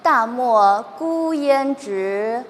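loud, slow recitation with long-held syllables; practice recitation. Shown as a demonstration. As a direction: project each phrase and stretch 直 and 圆. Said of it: "你试一下".

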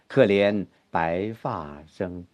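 soft, low, slow verse; plaintive, too small. Shown as a demonstration. Teacher warns against losing grandeur, small plaintive recital. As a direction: shrink it to a small, mournful voice. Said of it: "那就悲切了".

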